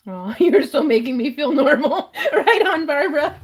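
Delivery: real giggles while thanking a viewer; laughing. Real, not acted. Laughing at a chat comment.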